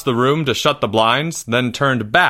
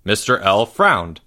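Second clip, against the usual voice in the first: ordinary delivery, nothing marked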